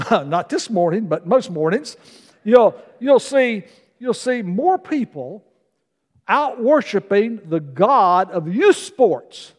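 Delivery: measured sermon cadence, building to the point; preaching. Real, not acted. Pastor warning the church the end is near, preaching.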